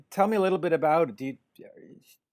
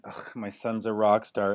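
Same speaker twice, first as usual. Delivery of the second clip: lower, grumbling Ugh, my son's a rock star with a groan; mock disgusted dad; 6 semitones lower, 3 dB quieter than usual. Teen rocker's dad before success, mock disgusted. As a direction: sneer it like a fed-up father. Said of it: "your dad must have been".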